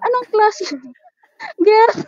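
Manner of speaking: high laughing disbelief. Real, not acted. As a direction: laugh at the strange food.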